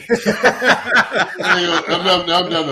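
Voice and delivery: cracking up while counting losses; laughing. Real, not acted.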